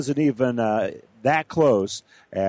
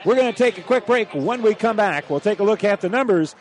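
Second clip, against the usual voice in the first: excited; 7 semitones higher than usual. Real, not acted. Still buzzing before break.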